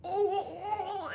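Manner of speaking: high soft pained oh; a small high whimper of pain. Retold or imitated. On the table as it hurts, high soft whimper. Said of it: "And I'm like".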